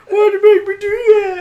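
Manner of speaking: very high, loud mock wail; whiny blaming. Friend mimics the kid blaming the storyteller, shrieky mock crying. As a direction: imitate a tearful whiner laying blame. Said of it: "he tried blaming me".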